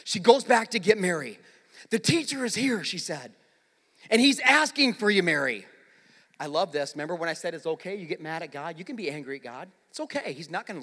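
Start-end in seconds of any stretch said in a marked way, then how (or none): 1.9-5.7 raised voice, falling on the name; projected preacher's quote; calling out. Retold or imitated. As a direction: call the news out to a sister. First, projected telling of Martha's call.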